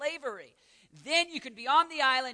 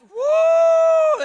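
long, high, loud "woo"; excited whoop; 17 dB louder than usual. Real, not acted. Preacher excited by the echoing hall, whooping. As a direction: whoop to make the room ring. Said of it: "I'm excited".

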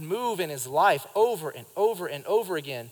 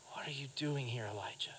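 soft, breathy whispered question; gentle whisper; 4 semitones lower, 15 dB quieter than usual. Retold or imitated. The voice of God whispering to Elijah in the cave. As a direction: whisper it close, kindly. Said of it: "came a gentle whisper".

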